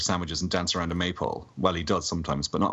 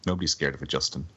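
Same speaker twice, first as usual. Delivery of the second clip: normal talking voice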